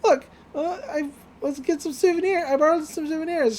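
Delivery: put-on high, squeaky, flustered girl voice; Mako's panicky voice. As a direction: imitate a ditzy girl trying to calm everyone. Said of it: "She's like".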